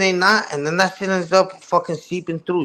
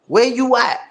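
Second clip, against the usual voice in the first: firmer taunt; 7 semitones higher than usual. Acted. Streamer repeating the question to the rival, pointed. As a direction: ask it again, flatter and pointed.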